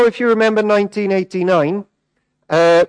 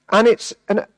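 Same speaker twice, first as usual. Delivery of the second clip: ordinary delivery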